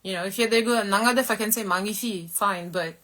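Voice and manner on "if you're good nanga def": ordinary talk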